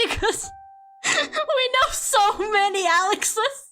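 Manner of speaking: high, giggly retelling; cracking up. Real, not acted. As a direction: push the quotes out through laughter. Cracks up retelling mom's reply.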